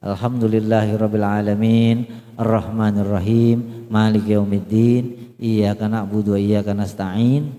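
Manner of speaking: low recitation with final vowels lengthened a little; measured reading, last syllable of each line held; gently swung endings. Shown as a demonstration. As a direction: let each verse ending sway slightly. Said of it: "Diayunlah dikit gitu ya".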